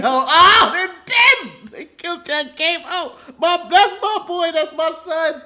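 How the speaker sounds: high, hammed-up anguish over the fallen; mock grieving fan. Retelling the show to his co-host, mock anguish. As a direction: play the shocked, grieving fan. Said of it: "And you go".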